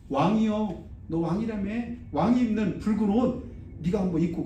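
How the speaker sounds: mildly mock a bow to the king; light sarcastic taunt. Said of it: "조롱하려고".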